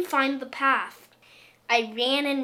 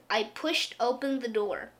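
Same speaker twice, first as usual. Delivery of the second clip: ordinary delivery, nothing marked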